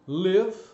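"live" said with a short closed vowel, like "lev"; clear model word. Shown as a demonstration. Teacher modelling "live" for Brazilian learners. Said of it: "algumas palavras muito legais aí que são".

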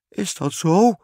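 high, quavering little question; tearful, wavering king. A narrator's reading. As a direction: ask tremblingly, close to tears. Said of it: "vroeg de koning met bibberende stem".

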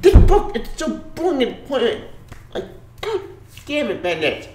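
high, strained whine of frustration; exasperated. Real, not acted. Reviewer cursing Bendis over a pointless book, exasperated. Fed up with the writer.

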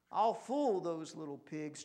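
ordinary delivery, nothing marked

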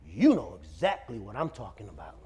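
low, softened knowing accusation; knowing menace. Retold or imitated. Possessed man singles out the officer, low knowing tone. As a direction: drop low and soft after the ranting.

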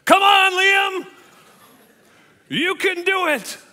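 wife's high, screaming cheer; imitating an overexcited mother cheering her son; loud cheering. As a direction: yell it drawn out from the bleachers. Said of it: "she's cheering so loud".

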